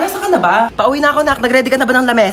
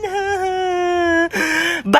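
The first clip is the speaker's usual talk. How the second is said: sob; 7 semitones higher, 3 dB quieter than usual. Acted. Wailing between lines.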